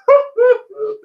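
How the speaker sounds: short burst of laughter at himself; rueful laugh. Real, not acted. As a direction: laugh it off, a little bitter. Laughing at only 56 views.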